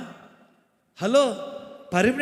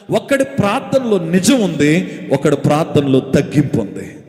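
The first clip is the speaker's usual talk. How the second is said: forceful preaching; 3 semitones lower, 8 dB louder than usual. Real, not acted. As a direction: drive the contrast home from the pulpit. Preacher stressing point to congregation.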